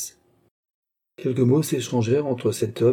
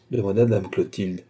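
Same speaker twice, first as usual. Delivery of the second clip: plain reading voice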